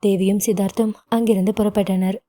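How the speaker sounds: plain reading voice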